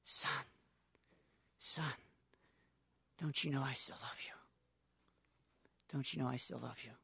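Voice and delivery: a father softly reassuring his son; tender father. Acted.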